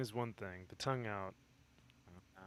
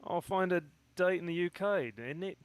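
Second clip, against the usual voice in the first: high, loud put-on Australian-Cockney accent; hammed-up mix of Australian and Cockney; mock Aussie-Cockney accent; 7 semitones higher, 5 dB louder than usual. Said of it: "Australian and, like, Cockney".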